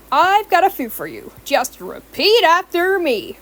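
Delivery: high, brisk instructor voice; instructing. A narrator's reading. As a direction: coach her like a teacher. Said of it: "Moxie instructed".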